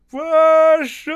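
high, loud, drawn-out cry; distant onlooker yelling the ice is moving; joyful howl. A narrator's reading. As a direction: call it out gleefully from the bank. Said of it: "невидимый голос радостно завыл".

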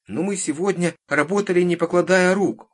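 put-on high, loud protesting child; insistent little girl. A narrator's reading. Girl arguing with the witch about work, insistent. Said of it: "не отступала девочка".

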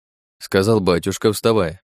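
ordinary delivery, nothing marked

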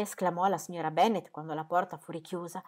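usual speaking voice

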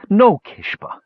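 high cry, woman's voice; cried out. A narrator's reading. Brandella begs Kishpa to stop, high cry. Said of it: "cried Brandella".

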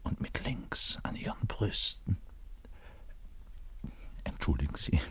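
hushed, breathy whisper, as if caught out. Acted. Bass player whispers his apology to the audience. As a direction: drop to a whisper of embarrassment.

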